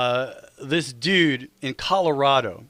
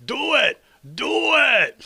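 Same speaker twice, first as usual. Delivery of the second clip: high, loud toy shout; gruff tinny action figure; 10 semitones higher, 3 dB louder, much slower than usual. Pretend. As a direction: bark it like a pull-string doll. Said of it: "I picture you saying something like that".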